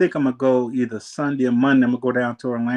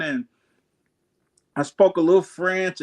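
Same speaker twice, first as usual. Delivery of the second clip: ordinary talk, nothing marked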